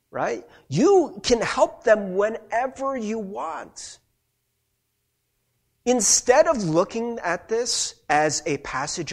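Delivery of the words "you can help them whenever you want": slow, with "You" pitched high; stress on YOU. Shown as a demonstration. Preacher hands responsibility to congregation, slow emphatic "You". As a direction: open high on "You" and take the line slowly. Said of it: "Why don't we emphasize the word you?".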